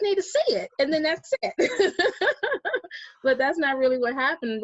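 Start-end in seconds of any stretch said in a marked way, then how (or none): 1.6-3.2 high, loud laugh at herself; rueful laugh. Real, not acted. Mocking her belief that posts would convert people, a rueful laugh.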